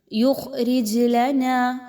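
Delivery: یخرج with a heavy خ and a bounced ج, very slow; teacher models qalqala in یخرج; bounced jeem. Shown as a demonstration. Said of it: "ہلا کر پڑھا جائے گا".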